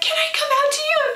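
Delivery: high, eager question; eager asking. Retold or imitated. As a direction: ask like an excited child.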